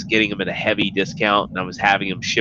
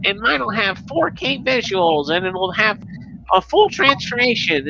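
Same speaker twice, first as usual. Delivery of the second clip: high whiny braggart voice; mock the rival bragging, pitched up high; mocking impression; 11 semitones higher than usual. High mocking voice imitating a rival commenter's boasts. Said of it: "guess who pops into his comment section and is like".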